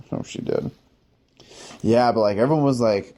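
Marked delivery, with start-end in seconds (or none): 0.1-0.7 soft unvoiced hope; whispered aside. Real, not acted. He hopes the coworker cared, whispered softly. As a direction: breathe it out hopefully. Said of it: "whispered hopeful aside".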